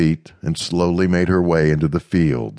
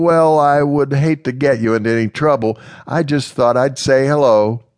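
put-on higher, louder girlish voice; young lady Amanda; 10 semitones higher, 4 dB louder than usual. Amanda confiding in Mister Smith, higher girlish voice. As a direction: voice Amanda a little higher and brighter.

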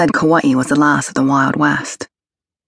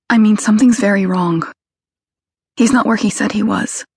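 put-on steadying, higher young woman; firm voice; 6 semitones higher than usual. A narrator's reading. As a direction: pull herself together, firmer. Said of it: "firmed her voice".

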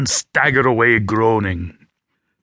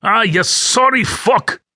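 much higher, slow, drawn-out cry of pain; pained shout; 10 semitones higher than usual. A narrator's reading. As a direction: howl the curse as the teeth sink in. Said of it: "drawing a shout from him".

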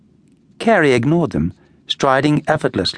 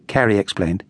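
plain reading voice

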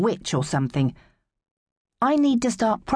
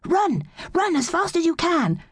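high, eager call down the stairs; child greeting mum home, excited high shout; 9 semitones higher than usual. Acted. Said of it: "I shout".

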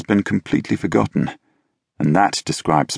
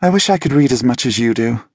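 Kurt's voice; 6 semitones higher than usual. A narrator's reading. Kurt flattering his new boyfriend Scott, high and admiring. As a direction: sound lightly admiring and high.

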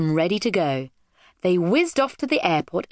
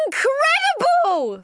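very high, drawn-out squeal of delight; excited scream; 4 dB louder, slower than usual. A narrator's reading. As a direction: scream it with glee, stretching the word. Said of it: "screamed Eugenia in excitement".

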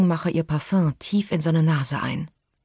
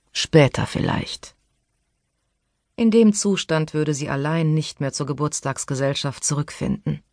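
plain reading voice

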